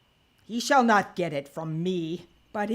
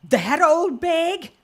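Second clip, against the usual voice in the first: scornful scoff; 12 semitones higher, 10 dB louder, slower than usual. Acted. Scornful old man on Cecilia.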